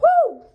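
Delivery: sudden high, loud whoop. Real, not acted. Reacting to the father's new girlfriend, a loud whoop. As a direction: blow off steam with a whoop.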